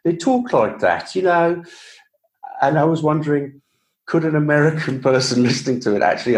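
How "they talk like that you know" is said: quick Brummie demo, pitched up; Birmingham accent. Shown as a demonstration. Showing how Birmingham folk talk, light Brummie accent. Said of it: "if I had a character come from Birmingham".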